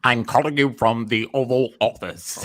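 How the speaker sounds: slow, lightly put-on president voice; a hint of a formal president on the phone; presidential address. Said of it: "That's the president, uh, Mister President".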